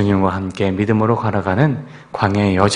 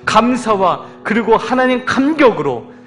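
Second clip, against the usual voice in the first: rising, louder, pleading prayer voice; fervent prayer; 12 semitones higher, 5 dB louder than usual. Acted. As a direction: build into full-force pleading prayer. Fervent prayer over music. (뜨겁게 부르짖는 통성 기도)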